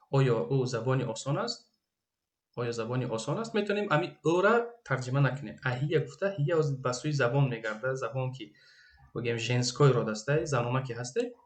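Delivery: normal talking voice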